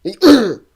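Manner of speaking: "League un" ending in a high, loud nasal grunt; exaggerated French pronunciation. Shown as a demonstration. Repeating the league name for laughs, "League un" with grunted French "un". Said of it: "it's the correct pronunciation".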